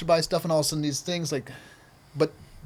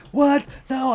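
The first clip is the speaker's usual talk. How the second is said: high, louder retold yelp of protest; mock scream; 8 semitones higher, 4 dB louder than usual. Injured skater refusing the makeshift splint, mock scream. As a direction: act out a shocked scream. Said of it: "I'm screaming".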